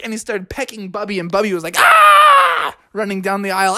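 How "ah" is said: very high, loud cub yelp; panicked yelp. Acted. Bubby runs from the woodpecker, yelping.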